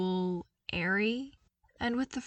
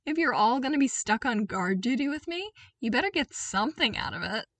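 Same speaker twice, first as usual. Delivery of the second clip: put-on lighter, upbeat young voice; bright eager invitation; cheerful Luke; 5 semitones higher, 4 dB louder than usual. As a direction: friendly call to the troopers.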